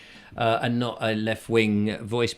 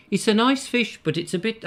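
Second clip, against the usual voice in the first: higher, badly faked Birmingham accent; Birmingham accent attempt; 7 semitones higher than usual. Shown as a demonstration. Trying a listener's tip phrase, shaky Birmingham accent attempt. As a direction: have a go at Brummie. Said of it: "I can't do it at all".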